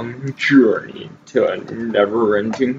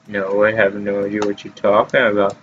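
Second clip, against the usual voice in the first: usual speaking voice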